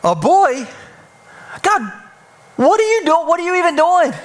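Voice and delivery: put-on a mother stunned it's a boy, complaining upward; shocked mother. Mother sees baby is boy.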